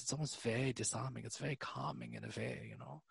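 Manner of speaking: soft, soothing German accent; calm German accent. Shown as a demonstration. Showing co-host calm German is disarming, soft German accent. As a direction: ease into a hushed German accent. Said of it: "when they go calm like this".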